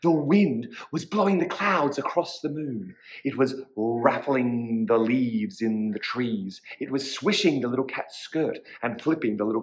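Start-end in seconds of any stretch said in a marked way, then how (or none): none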